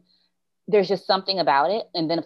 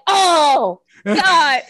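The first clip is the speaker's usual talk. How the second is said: loud, high comic groan of dismay; mock anguish; 10 semitones higher, 7 dB louder than usual. Teacher hears song called classic, loud mock anguish. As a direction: cry out as if wounded. Acted wounded reaction to student.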